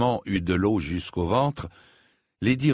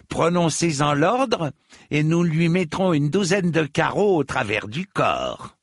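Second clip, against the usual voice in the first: eager threat; 8 semitones higher, 3 dB louder than usual. A narrator's reading. Henchman urges the order to shoot.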